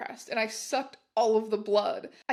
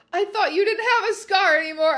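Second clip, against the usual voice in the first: loud, much higher crying shout; panicked shout; 8 semitones higher, 9 dB louder than usual. Acted. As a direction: shout in alarm. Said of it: "I shouted".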